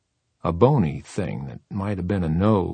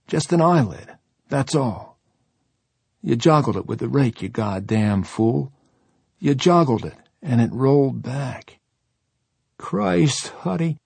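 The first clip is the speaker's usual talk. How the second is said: panicked; 6 semitones higher than usual. Acted. Boy panicking over a corpse.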